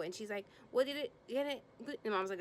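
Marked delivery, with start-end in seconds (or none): 0.7-2.0 high, stammering mock questions; flustered questioning. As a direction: imitate her sputtering questions in a raised pitch. Megan voiced firing flustered questions.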